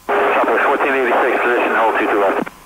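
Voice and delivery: tinny cockpit radio transmission; pilot over the radio. Real, not acted. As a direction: read back like a pilot on the radio. Pilot reading back on radio.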